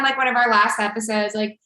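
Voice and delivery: usual speaking voice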